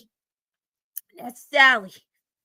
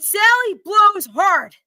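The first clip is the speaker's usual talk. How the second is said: very slow, louder and higher; shouted slogan; 5 semitones higher, 7 dB louder, much slower than usual. Acted. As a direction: bellow the ad word by word. Customer shouts ad text.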